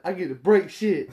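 high, loud imitated glee; too excited. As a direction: imitate a kid thrilled to wreck things. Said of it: "way too excited for this shit".

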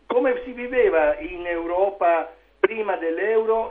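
ordinary talk, nothing marked

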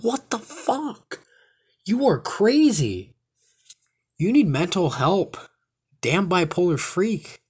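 high, slow mocking rant; mock outraged retort. Imitating his girlfriend's screaming, mock outraged. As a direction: scoff at her with drawn-out disbelief.

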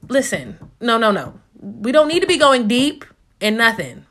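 normal talking voice

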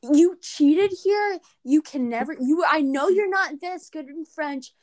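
mock outraged teacher accusation; retold teacher accusing. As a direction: imitate the teacher scolding her. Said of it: "And she was like".

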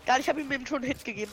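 usual speaking voice

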